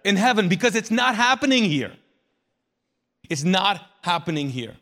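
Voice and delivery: loud, insistent correction; emphatic Jesus. Acted. Jesus correcting the crowd about prayer, loud and emphatic. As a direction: preach it forcefully, voice lifted. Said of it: "but Jesus is like".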